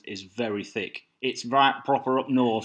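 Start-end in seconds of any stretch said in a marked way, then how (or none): 1.2-2.7 a broad northern English accent, lightly put on. Shown as a demonstration. Telling the Flash Gordon story, in a light northern English accent. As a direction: say it like a proud northern local. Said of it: "it sounds a bit like this".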